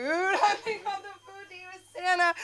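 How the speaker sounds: high, laughing through tears; laugh-crying. Real, not acted. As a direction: giggle while fake-sobbing. Laugh-crying about Santa food.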